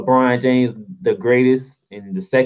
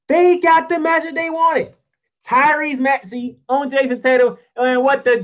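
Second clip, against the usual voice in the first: high-pitched indignant rant; exasperated outburst; 10 semitones higher than usual. Real, not acted. Host ranting at Boston's wasted matchup, exasperated outburst. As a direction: push it high in disbelief.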